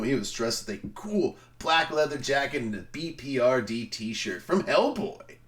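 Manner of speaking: ordinary talk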